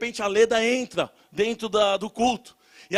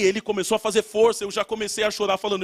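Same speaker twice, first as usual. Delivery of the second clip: ordinary delivery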